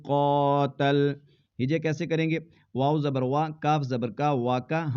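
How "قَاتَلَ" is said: slow, drawn-out Quranic recitation with long pauses; measured tajweed reading. Shown as a demonstration. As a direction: recite each word slowly and apart. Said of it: "tajweed reading demo".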